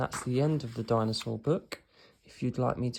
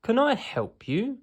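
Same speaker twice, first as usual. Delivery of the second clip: much higher, cheery shopkeeper greeting; beaming shopkeeper; 12 semitones higher, 5 dB louder than usual. A narrator's reading. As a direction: greet the customers with a big beam. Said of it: "beams Mister Fox".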